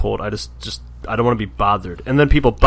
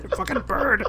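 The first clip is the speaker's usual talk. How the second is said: put-on scared kid voice; 7 semitones higher, 5 dB quieter, slower than usual. Voicing scarred kids about Fredbird.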